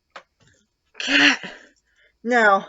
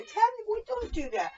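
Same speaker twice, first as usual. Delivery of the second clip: calling the cat; 13 semitones higher, 7 dB quieter than usual. Real, not acted. Owner calling her cat, high sing-song.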